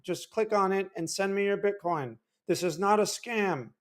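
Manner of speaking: Michael Saylor impression. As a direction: hype your coin like a meek crypto guru. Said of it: "that's my Michael Saylor impression".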